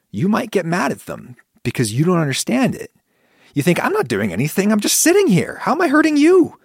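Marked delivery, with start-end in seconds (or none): 3.8-6.6 rising indignant protest, climbing in pitch; indignant defensiveness. Retold or imitated. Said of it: "And you get angry".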